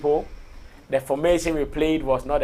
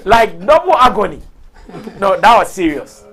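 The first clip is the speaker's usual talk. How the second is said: excited; 5 semitones higher, 9 dB louder than usual. Real, not acted. Excited laughing rant about bets.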